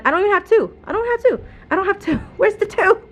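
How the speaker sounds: rising panic at a missing book; frustrated disbelief. Real, not acted. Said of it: "you can hear it in my voice that I'm getting a little bit frustrated".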